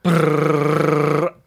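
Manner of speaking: rolled lips for a playful drum roll. Pretend. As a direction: trill it like a snare drum roll. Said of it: "drum roll".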